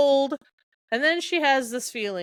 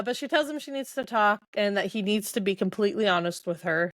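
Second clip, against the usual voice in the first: ordinary talk, nothing marked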